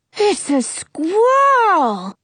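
high, drawn-out slow exclaim; excited cry. Acted. Jonah spotting the squirrel, crying out slowly. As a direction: stretch it out in amazement. Jonah's eyes pop wide.

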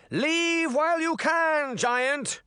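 high, slow shouted warning; high-pitched, drawn-out call. A narrator's reading. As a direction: call up a challenge to a giant. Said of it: "Charming shouted".

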